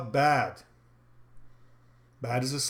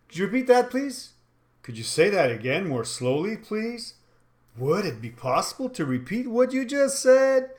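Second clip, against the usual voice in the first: higher-pitched casual demand, lifting at the ends; informal tone; 6 semitones higher than usual. Shown as a demonstration. Trainer shows the wrong tone to ask a customer, informal. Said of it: "Now, that is certainly informal".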